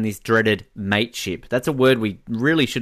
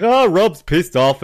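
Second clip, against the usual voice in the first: gleeful mock; 6 semitones higher, 5 dB louder, slower than usual. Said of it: "he's basically there going like".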